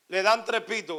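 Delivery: ordinary talk, nothing marked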